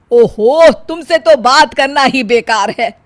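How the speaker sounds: loud, fed-up mother-in-law; mother-in-law giving up on her daughter-in-law; exasperated. Acted. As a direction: throw up your hands with your voice.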